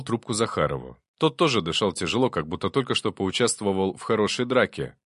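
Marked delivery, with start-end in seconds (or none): none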